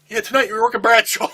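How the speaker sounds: loud, high call across the room; retold shout. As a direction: bark out the bad news. Johnny Ace yells the assignment.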